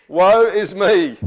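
loud, high, drawn-out lament; dramatic woeful cry. Acted. Isaiah responding to the sight of God, loud anguished wail. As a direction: cry it out slowly, doomed, at full voice.

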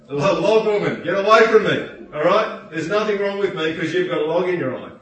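hammed-up indignant yell, half laughing; mock indignant shout. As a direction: shout back at the log woman in mock offence. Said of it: "the person with the speck can't say".

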